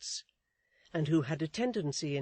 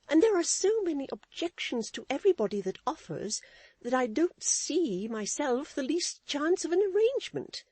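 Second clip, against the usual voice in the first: much higher, prim lady's voice; Missus Chick's voice; 10 semitones higher, 6 dB louder than usual. A narrator's reading. Wife lecturing her husband, in a high prim voice. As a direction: preach a lesson, high and proper.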